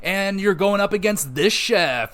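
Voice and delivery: high, loud, hyped TV host announcement; cooking show host. Retold or imitated. Cooking show crew bursting into the restaurant, hyped host voice. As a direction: pitch it up like a game-show host springing a surprise. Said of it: "They're like".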